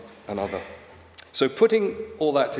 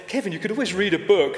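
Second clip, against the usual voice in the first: mum's suggestion; 5 semitones higher than usual. Retold or imitated. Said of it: "his mum would say".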